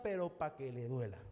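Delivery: low, softened aside with a sting; quietly barbed, acted; pointed politeness. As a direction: drop the voice for a veiled jab. Polite reply meant to sting.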